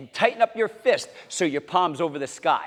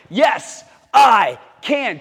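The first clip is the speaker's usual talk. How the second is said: louder, higher shout; stronger shout; 4 semitones higher, 7 dB louder, much slower than usual. Shown as a demonstration. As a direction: shout it out with full strength. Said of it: "A little stronger now".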